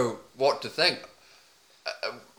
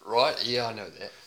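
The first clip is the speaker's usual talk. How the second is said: a hint of a laconic Aussie bloke accent; deadpan Aussie accent, mostly his own voice; put-on Aussie accent. Podcaster riffing about a stranger in a light put-on Aussie accent. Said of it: "I've already got my accent".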